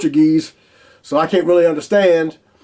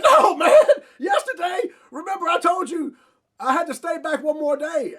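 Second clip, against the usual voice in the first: high panicked disbelief; 11 semitones higher, 4 dB quieter than usual. Retold or imitated. Pleading with the base logistics guy, high panicked voice. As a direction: voice jumps way up, pleading. Retold panic at airport counter.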